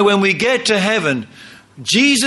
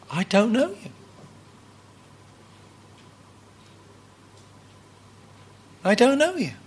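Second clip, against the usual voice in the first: higher, softer, sorrowful refusal; Jesus rejecting; 7 semitones higher, 4 dB quieter than usual. Acted. Jesus turning away the preachers, soft and sorrowful. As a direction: turn them away quietly, with regret.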